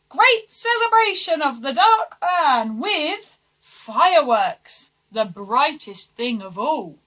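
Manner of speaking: loud, slow town crier announcement; grand announcement. Acted. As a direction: proclaim the party to the kingdom. Advisers announcing party.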